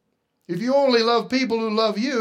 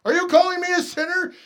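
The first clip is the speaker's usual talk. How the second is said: louder, indignant protest, higher pitched; offended churchgoer; 10 semitones higher, 7 dB louder, faster than usual. Retold or imitated. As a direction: bristle like a churchgoer stung by the word. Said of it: "Can't you just hear it?".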